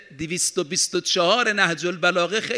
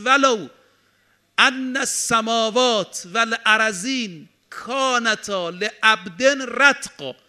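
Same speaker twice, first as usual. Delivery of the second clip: forceful Arabic recitation; 4 semitones higher, 3 dB louder, slower than usual. Acted.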